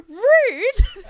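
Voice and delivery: high, loud "rude" in playful offence; mock outrage. Host reacting to a listener praising someone else as the star, mock outraged. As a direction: cry out as if jokingly insulted.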